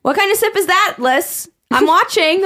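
high, mock-stern teasing voice; teasing friend. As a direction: play the friend catching her sipping, half-laughing. Friend teasing her drinking.

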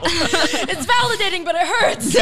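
laughing high and loud through the words. Real, not acted. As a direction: laugh it out, half wincing. Laughing at a painful joke.

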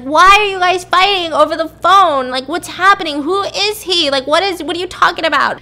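put-on a frightened kid pleading from the back seat; upset child. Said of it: "Olivia starts crying in the back".

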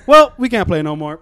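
a hint of a matter-of-fact kid; lightly voiced chubby kid. Classmate calling off the game, light kid voice.

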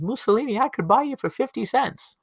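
put-on very high, squeaky kid voice; little kid crying out. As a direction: cry out as a small boy in a crowd. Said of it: "cries out in the crowd and says".

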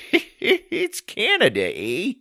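high, slow chuckling Canadian; chuckle, then draw it out cheerfully; Canadian character. Acted. Barry shrugs off the favour.

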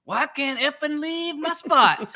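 high, slow, strained retold voice; gruff guy voice. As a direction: voice him high and dragged out. Retold guy stuck in spot.

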